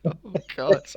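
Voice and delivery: usual speaking voice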